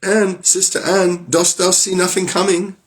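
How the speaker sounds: frightened wife calling up to sister Anne; anxious call. Acted. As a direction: call up the tower, hoping. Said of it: "cried out from time to time".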